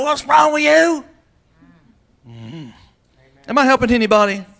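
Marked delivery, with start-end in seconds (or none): 0.0-1.0 high, loud, slow scolding yell; mock yell. Preacher acting out yelling at a dysfunctional relative, loud. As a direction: bellow the question in mock disgust.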